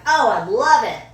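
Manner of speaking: loud, high imitated gushing; delighted acceptance. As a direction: jump at the invitation, hammed up. Delighted acceptance of drink invite.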